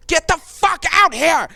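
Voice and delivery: loud, high angry yell. Acted. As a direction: bark the order into the dark. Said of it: "He yells back into the orb".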